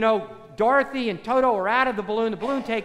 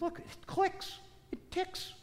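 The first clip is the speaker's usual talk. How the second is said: hushed wizard; 9 semitones higher, 11 dB quieter, slower than usual. Acted. Wizard shows the heart clock.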